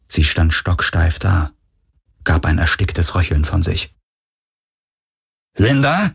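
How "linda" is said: a rising, uncertain call of her name; worried call. A narrator's reading. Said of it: "rief Parker". (besorgt fragend rufen)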